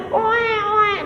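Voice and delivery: high mock wail of a hurt child; imitated baby crying. Mother mimics her child crying, mock wail. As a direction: whimper like a little kid crying.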